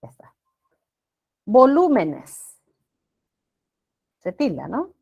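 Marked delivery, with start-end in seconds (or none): 1.5-2.6 Volúmenes drawn out, loud and high on LU; stress on second syllable. Shown as a demonstration. Teacher shows the class Volúmenes is esdrújula, loud stress on LU. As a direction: punch the LU in Volúmenes, stretching it. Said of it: "se convierte en esdrújula".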